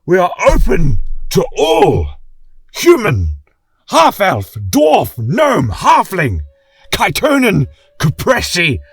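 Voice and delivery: a king whipping the crowd into a roar; rousing shout. A narrator's reading. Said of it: "with deep-seated passion".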